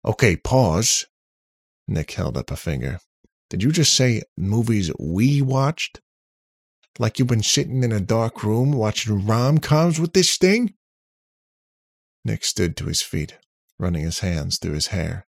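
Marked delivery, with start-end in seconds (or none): 0.1-1.1 loud, slow, higher halt; incredulous interruption. A narrator's reading. Nick interrupts in disbelief. 3.5-10.7 loud, high disbelieving questioning; indignant, pitched-up interrogation; incredulous. A narrator's reading. Nick incredulous at Bill.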